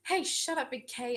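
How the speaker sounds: high, loud mock telling-off; mock indignant. Real, not acted. Streamer telling teasing viewer Big K off, mock indignant. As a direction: cut in loudly, playfully affronted.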